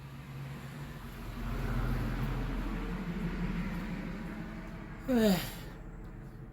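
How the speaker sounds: long weary breathy sigh. Real, not acted. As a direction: let out a long sigh of relief. Recovering from eating a Reaper.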